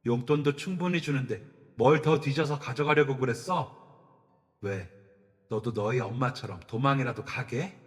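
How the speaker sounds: loud, huffing interrogation; a father raging at his son; father's furious scolding. Acted. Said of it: "씩씩대더라고요". (씩씩대며 아들을 다그치는 아버지)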